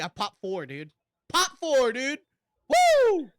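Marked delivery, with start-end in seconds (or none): 1.3-3.3 loud, high whoop of pride; hyped cheer. Real, not acted. Bragging to his friend, a loud high whoop. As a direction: cheer it out, louder and higher.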